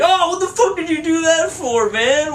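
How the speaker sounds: high, loud indignant yelp; outraged stranger. Retold or imitated. Stranger with broken nose protesting, high and outraged. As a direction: squeal it like a guy just punched. Punched guy voiced in story.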